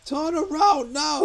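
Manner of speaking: hammed-up chef bellow, very high, slow and loud; Gordon Ramsay. Chef as sat-nav ordering a driver back, shouted impression. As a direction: shout the order in drawn-out mock fury. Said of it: "Gordon Ramsay telling me how to drive".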